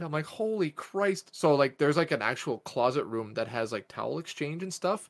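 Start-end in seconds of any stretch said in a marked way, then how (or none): 0.3-1.3 drawn-out, higher retold exasperation; mad. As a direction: replay a slow, exasperated curse. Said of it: "'Cause I'm so fucking mad".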